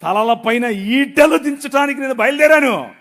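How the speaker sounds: voice of God. Acted. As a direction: declare it as the Lord. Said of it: "ప్రభు అంటున్నాడు".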